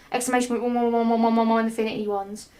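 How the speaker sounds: fast, garbled rush through the ones. Shown as a demonstration. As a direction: rattle it off breathlessly. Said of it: "'Cause I can't say all that".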